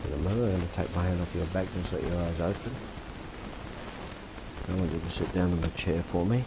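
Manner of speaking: usual speaking voice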